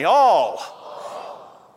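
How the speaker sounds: "All" stretched out in a Louisiana drawl for the crowd to echo; Louisiana accent. Shown as a demonstration. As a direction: lead the room in a long Louisiana "All". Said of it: "Say all with me".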